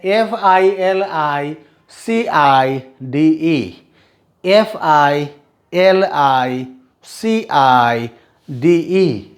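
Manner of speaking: letters spelled slowly, one by one; slow spelling out. Shown as a demonstration. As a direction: dictate each letter slowly for the class. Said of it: "मैं सिर्फ spellings पढ़ूंगा".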